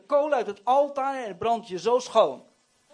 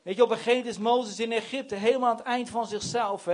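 normal talking voice

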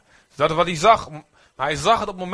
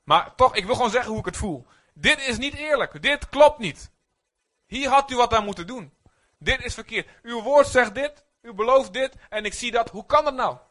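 impassioned complaint; 7 semitones higher than usual. Shown as a demonstration. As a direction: pour out the frustration in prayer. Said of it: "En zeg ik". (hoog en hartstochtelijk klagend gebed)

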